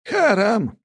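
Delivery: high elder, smug correction; condescending. A narrator's reading. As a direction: correct him with a patronising smile. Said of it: "снисходительно улыбнулся". (снисходительно)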